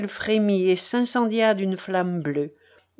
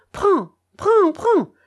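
much higher, loud sobbing cry; 11 semitones higher, 6 dB louder, slower than usual. Acted. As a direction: thrust it at him, voice breaking. Grandfather breaking down.

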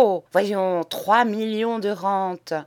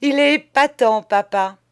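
higher and louder, crying out in glee; laughing exclamation; 5 semitones higher, 6 dB louder than usual. Acted. Son crying out gleefully about his father, louder and higher. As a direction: burst out, giggling at the joke. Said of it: "pouffant davantage, s'écria-t-il".

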